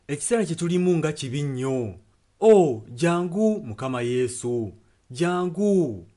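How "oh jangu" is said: ordinary delivery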